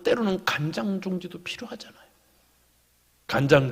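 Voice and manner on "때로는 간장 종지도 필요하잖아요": confiding whisper. Real, not acted. Confiding point to the congregation.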